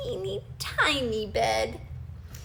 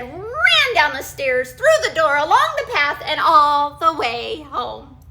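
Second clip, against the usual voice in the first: excited; 9 dB louder than usual. A narrator's reading. Narrating the escape, building.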